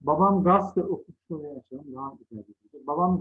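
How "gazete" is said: "gazete" shortened to gaste; misspelt form. Shown as a demonstration. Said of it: "hatalı yazılan sözcüklerin altını".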